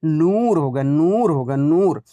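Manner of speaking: नूर with a long oo each time; drawn-out vowel. Shown as a demonstration. Teacher gives the correct pronunciation, नूर drawn out. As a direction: stretch every नूर. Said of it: "वाव को खींच कर पढ़ा जा रहा है".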